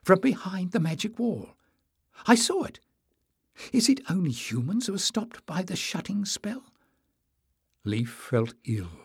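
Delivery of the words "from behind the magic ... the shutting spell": put-on higher, eager girl's voice; excited. A narrator's reading. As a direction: rush it out with breathless eagerness. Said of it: "whispered Jasmine excitedly".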